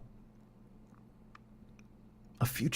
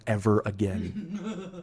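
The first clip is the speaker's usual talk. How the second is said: a slow line breaking into a laugh; much slower than usual. Real, not acted. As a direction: laugh as you finish it. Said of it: "ends with a laugh".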